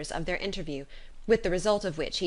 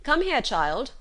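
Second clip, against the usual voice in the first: put-on higher, a father summoning his child; calling to his daughter; 7 semitones higher, 5 dB louder, slower than usual. A narrator's reading. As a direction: call her in firmly. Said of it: "cried her father as she appeared".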